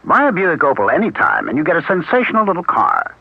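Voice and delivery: upbeat sales pitch; ad read. Acted. Announcer selling a car to listeners, upbeat. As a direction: sell the car brightly.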